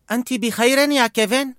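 put-on a worried boy begging; young boy voice. A narrator's reading. Boy pleads with old man to help bird.

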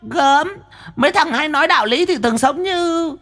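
very high, teasing woman's voice; mocking medium. Medium taunting Quý, very high and teasing.

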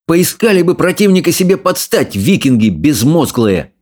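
high, indignant snap; angry bark. A narrator's reading. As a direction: bark a reproach at the others. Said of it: "рявкнул на них Икинг".

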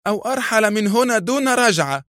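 high-pitched angry ultimatum. A narrator's reading. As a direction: threaten angrily, pitch held high. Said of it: "فأضافت في غضب".

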